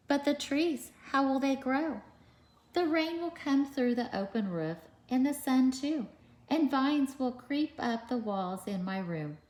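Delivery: animated picture-book read-aloud; reading to children. A narrator's reading. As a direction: read it out to a class of little kids.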